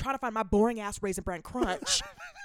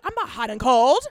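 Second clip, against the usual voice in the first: snapped; 2 semitones higher, 7 dB louder, slower than usual. Real, not acted. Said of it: "the way that you just snapped at me".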